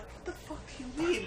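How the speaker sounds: high, fast disbelieving voice; exaggerated shocked disbelief; incredulous outburst. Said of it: "I'm like".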